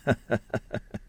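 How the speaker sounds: soft, rippling character laugh; unhurried chuckle. A narrator's reading. Taoist is recognised by old acquaintance, unhurried chuckle. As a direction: chuckle at ease before answering. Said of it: "从容笑道".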